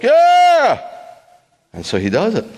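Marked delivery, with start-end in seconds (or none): 0.0-0.8 high, loud cheer; crowd shout. Retold or imitated. Crowd cheering the tightrope walker, loud and high. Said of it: "Everybody's like".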